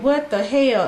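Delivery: retold shock, voice pushed up; shocked outburst.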